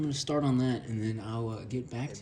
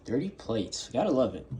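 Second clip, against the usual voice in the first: ordinary talk, nothing marked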